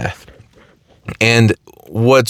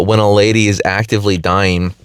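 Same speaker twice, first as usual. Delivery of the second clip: ordinary talk, nothing marked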